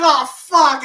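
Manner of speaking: acting out a jump scare; retold fright. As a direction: blurt it as if scared.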